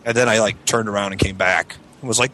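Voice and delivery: ordinary delivery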